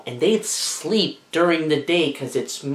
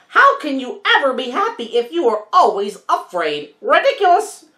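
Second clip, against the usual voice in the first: much higher, fast scornful parrot; scoffing parrot; 9 semitones higher than usual. Acted.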